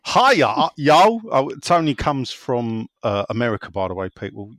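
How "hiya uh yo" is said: put-on American accent. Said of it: "I'll try and do an American accent".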